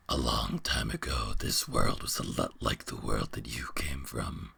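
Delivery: very low, hushed monster voice; deep whispering beast. Acted. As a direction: breathe it out like an ancient beast. Beast tells its history.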